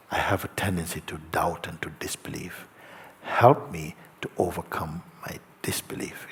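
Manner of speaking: a soft, humble whispered plea; hushed prayer, barely voiced; honest whisper. Shown as a demonstration. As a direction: whisper it like a private confession. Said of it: "when you can speak like this".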